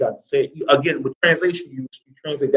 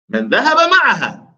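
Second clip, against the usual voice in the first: raised-pitch questioner's voice; dialogue questioner; 6 semitones higher than usual. Acted. Teacher reading the questioner's part to a student, high voice. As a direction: lift the whole question high, as the other speaker.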